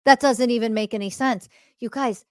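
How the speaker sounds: usual speaking voice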